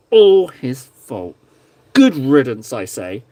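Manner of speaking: slow, drawn-out hammed-up accusation; slow mock-dramatic blame. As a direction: stretch it out like a pantomime villain's blame. Mock blaming James.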